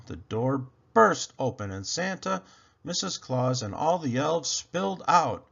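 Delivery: usual speaking voice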